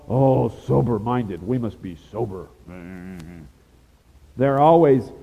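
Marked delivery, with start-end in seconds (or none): 0.1-2.5 mock solemn. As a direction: parody a joyless churchgoer. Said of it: "they're just all".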